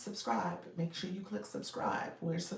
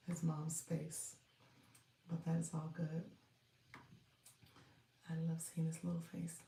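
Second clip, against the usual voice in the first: hushed, talking to yourself; real quiet murmur, almost private; near whisper; 8 dB quieter than usual. Real, not acted. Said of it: "you're whispering".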